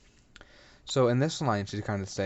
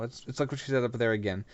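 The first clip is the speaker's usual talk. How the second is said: ordinary talk, nothing marked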